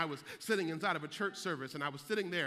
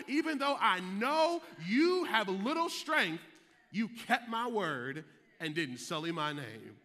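rising preaching; 3 semitones higher, 4 dB louder, slower than usual. Acted. Pastor voicing Jesus to his congregation, preaching with rising power. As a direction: preach Jesus's words slowly with a building lift.

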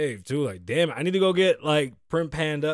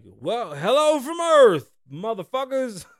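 loud, high, drawn out hype; hype intro voice; 5 semitones higher, 6 dB louder, slower than usual. Pretend. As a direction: boom it out like a big intro. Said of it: "where I'm like".

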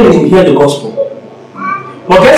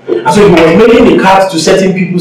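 normal talking voice

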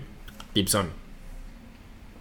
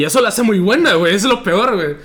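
excited; 9 semitones higher, 6 dB louder than usual. Real, not acted. Heated echo of partner's point.

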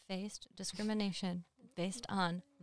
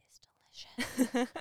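mock whisper; 5 semitones higher, 4 dB louder, slower than usual. Joking about eating blood sausage.

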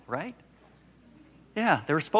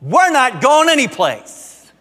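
much higher, louder and slow, each word planted; stubborn toddler refusal; 11 semitones higher, 10 dB louder, slower than usual. Retold or imitated. Granddaughter refusing to move, high, loud and slow. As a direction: dig in like a defiant child.

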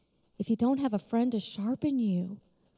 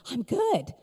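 joyful realization; 5 semitones higher, 5 dB louder than usual. Retold or imitated. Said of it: "I laughed, like, the biggest laugh".

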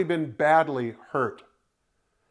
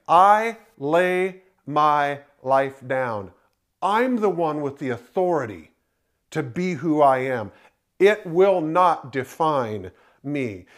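slow, solemn declaration; 3 semitones lower, slower than usual. Retold or imitated. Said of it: "And yet he's saying".

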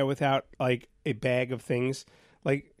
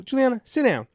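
higher-pitched retold parental order; retold firm order; 9 semitones higher, 4 dB louder than usual. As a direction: tell your kid firmly to sit. Retold firm order to his daughter.

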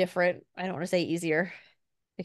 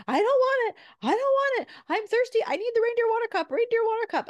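high, loud mock tantrum; hammed-up toddler wail, pitched way up; 5 dB louder than usual. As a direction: imitate a small child refusing at full whine. Mother voices child's tantrum.

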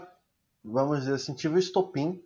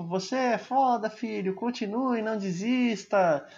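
a lightly voiced mother, higher and slow; mother's love; 8 semitones higher, slower than usual. Son imitating his mother urging him not to quit, tender and slow. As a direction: gently urge your son on like a loving mom. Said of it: "amor de mãe".

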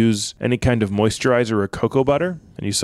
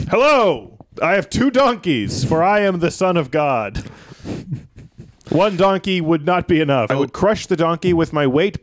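high, grand hammed-up announcement; mock-majestic declamation; Jesus proclaiming; 5 semitones higher than usual. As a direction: proclaim it pompously, pitched up. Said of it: "Jesus is like".

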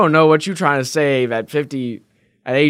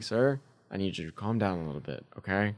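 soft, much quieter calming plea; calming the customer; 8 semitones lower, 15 dB quieter, faster than usual. Retold or imitated. Cashier calms heated customer, softly. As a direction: ease him down gently, softer. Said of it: "'Cause he was getting heated".

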